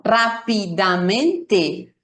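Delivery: "rápidamente" very slowly, every syllable equally long. Shown as a demonstration. Teacher showing learners equal syllable length, "rápidamente" syllable by syllable. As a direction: break "rápidamente" into even, fully sounded syllables: rá-pi-da-men-te. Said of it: "todas las sílabas se escuchan".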